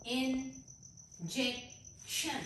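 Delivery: Injection drawn out very slowly and softly; very slow word. Shown as a demonstration. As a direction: ease injection out gently for the class. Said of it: "इसके तीन syllables हैं".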